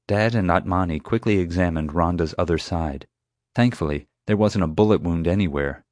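ordinary delivery

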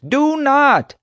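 very high, loud scream; screamed command. A narrator's reading. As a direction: yell it in desperate protest. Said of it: "Hoenikker screamed".

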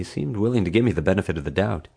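usual speaking voice

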